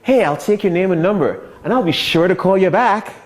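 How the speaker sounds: lightly mocking, cheery salesy promise; imitating brush-off. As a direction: mimic the empty phone promise. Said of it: "when they say".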